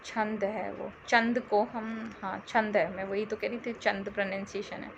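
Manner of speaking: low, very soft aside; muttering to self. Real, not acted. Teacher checking the word's pronunciation to herself, muttered low and soft.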